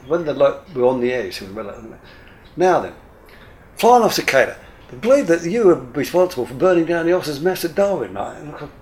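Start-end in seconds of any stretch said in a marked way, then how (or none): 2.6-8.4 high, loud showman host; spring the question like a TV host; TV presenter. Retold or imitated. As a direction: television host surprising the guest on air, loud and high.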